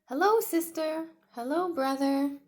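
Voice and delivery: high, loud, cheerful hello; Tiny's greeting. Acted. Tiny greeting new friends, high and cheerful. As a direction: greet them brightly as the little T-Rex.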